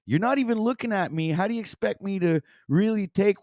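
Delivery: high-pitched frustrated rant at the speaker; exasperated rant. Acted. Yelling at the guru video in frustration. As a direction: let the irritation boil over.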